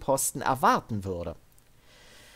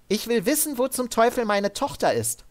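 higher, firm but controlled order; armored soldier woman; 11 semitones higher, faster than usual. Acted. Sid ordering Devin up at gunpoint, higher and firm. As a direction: keep him steady with a calm threat. Sid's voice.

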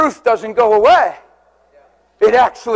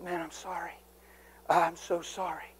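barely audible, lower; hushed sympathy; 6 semitones lower, 19 dB quieter than usual. Retold or imitated. Pastor to grieving son, hushed sympathy. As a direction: whisper the condolence. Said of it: "I said".

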